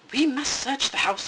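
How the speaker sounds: Papa Bear angrily ordering a search; angry growl. Acted. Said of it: "he growled".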